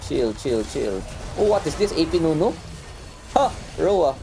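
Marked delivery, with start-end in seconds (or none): 1.4-4.1 higher, startled question; surprised. Real, not acted. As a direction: react in real disbelief. Spots odd enemy build.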